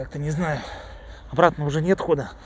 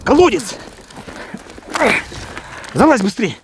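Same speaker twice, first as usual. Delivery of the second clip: urgent shout; 8 semitones higher than usual. Real, not acted. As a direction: yell at him to run. Fleeing guards.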